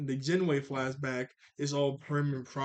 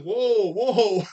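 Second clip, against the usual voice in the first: loud, high startled whoa; hammed-up alarm as Aang jumps in; 6 semitones higher, 10 dB louder than usual. Aang shouting whoa to stop the fight.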